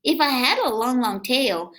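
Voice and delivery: lively, sing-song read-aloud voice; reading to children. A narrator's reading. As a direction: read it to little kids with bounce. Reading picture book aloud.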